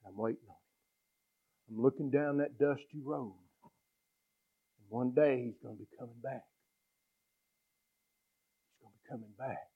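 a hint of a father aching for his son; tender longing father. Father on the porch waiting for his boy, softly hopeful. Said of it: "He says".

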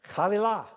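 "Chalilah" high and loud, accented on the final "lah"; stress on last syllable. Shown as a demonstration. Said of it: "probably on the last syllable".

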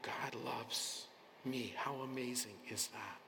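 usual speaking voice

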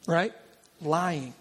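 usual speaking voice

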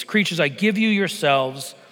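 ordinary talk

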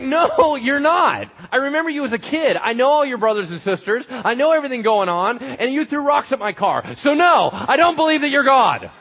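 high, loud scoffing denial; indignant neighbour. Acted. Neighbour scolding Jesus: loud, high, indignant. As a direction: refuse to believe the local kid.

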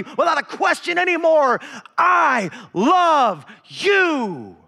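fervent climactic preaching; preaching at full force. Acted. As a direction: thunder it at the congregation.

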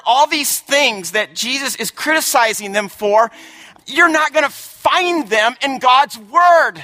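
rising pulpit intensity, ending in shouted lines; preaching at full force. Acted. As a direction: drive it home like a fired-up preacher.

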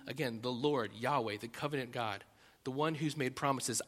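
normal talking voice

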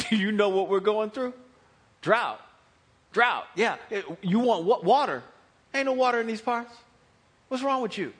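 loud, indignant pushback; mock outrage, punchy and loud; indignant local. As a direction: act put out by a stranger's nerve. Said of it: "how most of you might respond".

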